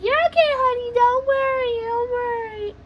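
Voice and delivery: high, loud singsong reassurance. Retold or imitated. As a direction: brush off the fear in a high put-on voice.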